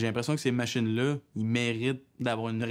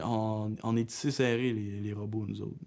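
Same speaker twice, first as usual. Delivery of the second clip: normal talking voice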